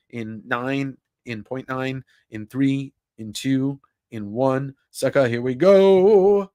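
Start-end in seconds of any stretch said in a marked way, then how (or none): 5.3-6.5 slow, loud, high hype call; excited announcement. Real, not acted. Streamer announcing the giveaway to chat, hyped. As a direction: draw it out like a game-show host. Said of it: "announcing the giveaway winner".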